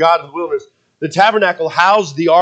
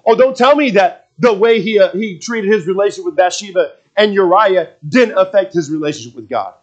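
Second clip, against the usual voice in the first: emphatic, raised-pitch sermon delivery; impassioned preaching; 4 semitones higher than usual. Real, not acted. Preacher warning that hurting people affects closeness to God, impassioned. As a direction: drive the point home to the congregation.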